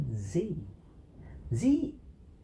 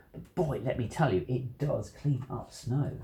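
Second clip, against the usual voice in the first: usual speaking voice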